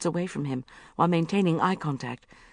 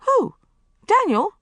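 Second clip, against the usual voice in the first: high, startled question; surprised; 8 dB louder than usual. A narrator's reading. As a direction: blurt it out in shock. Bridget confused at police.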